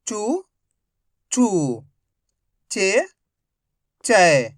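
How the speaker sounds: slow, measured drill chant, syllable by syllable; teacher drilling the Hindi syllable chart; barakhadi recitation. Shown as a demonstration. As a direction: recite each syllable clearly for the class to repeat.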